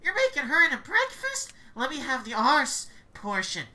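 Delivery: high, loud greedy cannibal voice. Acted. Cannibal staffer begging the cook for the witch's rump, loud and greedy. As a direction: leer at the witch like a hungry ghoul.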